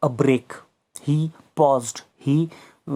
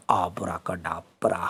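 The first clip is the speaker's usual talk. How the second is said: put-on Abracadabra chanted low and soft like a cranky old wizard; irritable old wizard; 7 semitones lower, 5 dB quieter than usual. Shown as a demonstration. Teacher redoing the spell word for the class, low wizard chant. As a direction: drop low and grumble Abracadabra. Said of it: "in the wizard's elderly, irritable voice".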